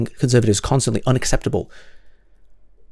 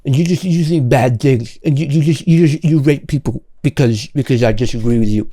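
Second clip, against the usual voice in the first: mocking imitation. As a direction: parody the other side's argument with mild scorn. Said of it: "rather than just being like".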